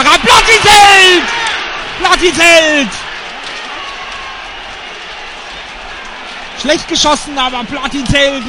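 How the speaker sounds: ecstatic screaming save call; screaming goal commentary. Real, not acted. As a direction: scream the keeper's save with joy. Penalty saved, live play-by-play.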